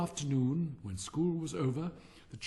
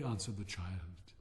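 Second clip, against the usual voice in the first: ordinary delivery, nothing marked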